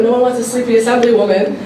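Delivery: ordinary talk, nothing marked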